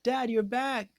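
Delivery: put-on high, light boyish call to Dad; kid voice. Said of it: "much like a kid".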